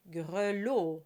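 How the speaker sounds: grelot said slowly with a closed o at the end; closed final o. Shown as a demonstration. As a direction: round the closing o of grelot. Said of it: "O is part of the final vowel".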